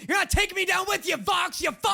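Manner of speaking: frantic high yell; yelling in mock panic; panicked yelling.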